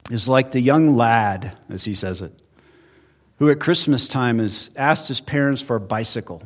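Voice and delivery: ordinary talk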